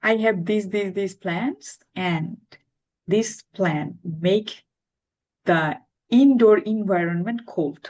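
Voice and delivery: slow and deliberate example sentence; teacher modelling a simple sentence word by word; model sentence. Shown as a demonstration. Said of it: "শুধু এটুকু বলেন".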